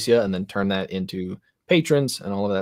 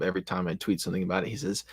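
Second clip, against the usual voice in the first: normal talking voice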